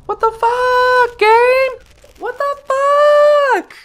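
shrieking falsetto, drawn out and loud; long, piercing yell at the game; high screamed exasperation. Real, not acted. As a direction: scream it very high and stretch the curse. Screaming at game as spiders attack.

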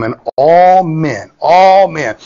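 "all" drawn out and punched; stress on ALL. Real, not acted. Preacher repeats the verse for the congregation, "all" strongly stressed. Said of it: "I'll say that again".

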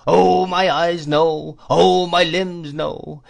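put-on high, loud, slow old man's whine; crazed old dealer's swelling, falling wail; singsong wailing cry. A narrator's reading. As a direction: draw out each cry like a gust of wind. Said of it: "delivered in a sort of tune".

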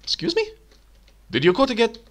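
high startled question; shocked. Real, not acted. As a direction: react as if cheated. Shocked at missing rents.

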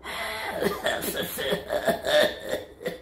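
rapid noisy breathing, no voice; comic hyperventilating; panting deep breath. Acted. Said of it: "Take a deep breath".

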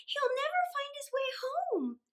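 put-on high child's voice, worried; worried girl. A narrator's reading. Mary frets to her father about the lost ant, high. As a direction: fret like a small girl.

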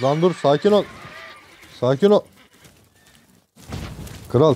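ordinary talk, nothing marked

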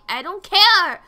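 loud, high-pitched outburst; playfully panicked gamer yell; exasperated shouting. Real, not acted. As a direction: yell over the small talk in exasperation. Exasperated outburst at game characters.

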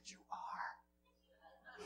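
soft whisper; whispered answer. Retold or imitated. Preacher reassures the passenger, whispered. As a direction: breathe it gently. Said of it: "I said".

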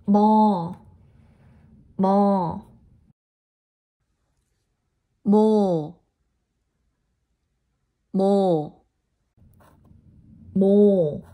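syllables called out loud for students to repeat; loud teaching model. Shown as a demonstration. Teacher calling out syllables loud for the class to repeat. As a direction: model each syllable firmly, like a teacher at the board.

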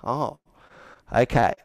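usual speaking voice

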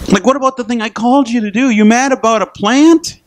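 high, fast, pointed challenge; God pressing Jonah about the plant; high, quick rebuke of a sulking prophet; God questioning. Retold or imitated. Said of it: "But God said to Jonah".

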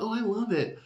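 high-pitched mock gush; light, high squeal of approval in retelling; retold gushing. Retold gushing change of mind.

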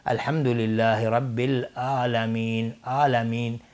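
slow recitation with plain a in «العالمین»; ain as alif. Retold or imitated. Teacher imitates some reciters' mistake, ain as alif. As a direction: say «العالمین» as if it began with hamza.

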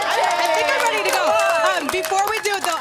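pumped up. Real, not acted. Pumped up before ice bucket.

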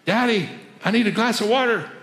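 put-on pleading small child calling downstairs; little boy. Little boy calling down to his father, light child voice. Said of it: "his little boy at the top of the stairs saying".

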